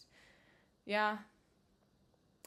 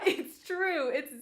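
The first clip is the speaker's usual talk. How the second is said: high, loud, laughing through the words; laughing admission; 10 semitones higher, 10 dB louder, much slower than usual. Real, not acted. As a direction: crack up while owning up to it. Cracking up at being caught out.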